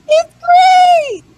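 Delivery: high, loud squeal of approval; gleeful shout. Retold or imitated. Fan defending favourite wrestler to friends online, gleeful shout.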